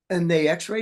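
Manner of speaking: usual speaking voice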